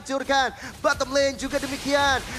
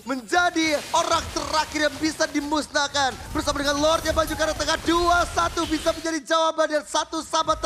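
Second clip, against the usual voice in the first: fighting game announcer; 9 semitones higher, 4 dB louder than usual. Real, not acted. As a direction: roar the wipeout like a final round. Caster shouts the wipeout.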